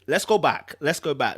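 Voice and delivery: usual speaking voice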